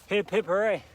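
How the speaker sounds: ordinary talk, nothing marked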